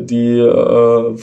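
ordinary talk

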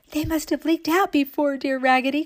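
high, eager agreement; excited cry. A narrator's reading. All the other dolls agreeing with Raggedy, high excited cry. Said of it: "cried all the other dolls".

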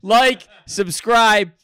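loud, high hype announcer; announcer sign-off. Acted. Host closing the podcast for Dave Hellum, shouted hype. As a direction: belt out the outro to the crowd.

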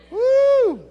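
high, loud whoop to rouse the crowd; a long high woo to wake the room; woo shout. Real, not acted. Preacher whoops to get the congregation talking, loud and high. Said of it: "That was just to try to get, get you all to talk".

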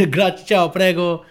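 put-on playful Italian accent; mock Italian. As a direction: rattle off Italian with a grin. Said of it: "Speak some Italian for the people".

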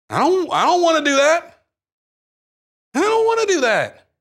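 put-on high, loud childish whine of refusal; whiny refusal. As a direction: whine it like a kid who won't do chores. Acted reluctance toward God's command.

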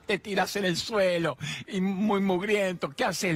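high, slow imitation of a scolding old mother; mock his mother's high-pitched scolding; teasing impression of mom nagging.

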